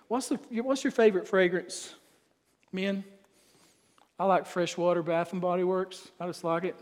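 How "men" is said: ordinary talk, nothing marked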